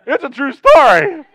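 high shout through laughter; loud laughing protest. Real, not acted. Preacher insisting to the congregation his joke is true, shouting. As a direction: insist at the top of your voice, laughing.

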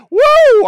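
cheer. Real, not acted. Cheering his own prayer.

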